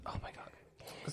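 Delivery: breathy whispered aside. Real, not acted. Storyteller aside before the story, whispered. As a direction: drop to a whisper, slow. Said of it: "whispered aside".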